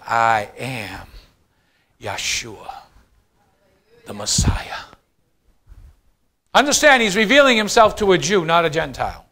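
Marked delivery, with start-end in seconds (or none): 0.0-4.8 low, hushed divine voice; deep hush sinking into a whisper; solemn revelation. Acted. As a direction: drop low and soft, pausing before each part. Jesus reveals his name, solemnly.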